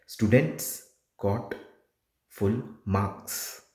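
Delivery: slow, spaced "Students got full marks" with strong S ending "Students" and "marks"; stressed final S. Shown as a demonstration. Tutor models stressing the S for the exam, final S stressed. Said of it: "you have to stress on the S".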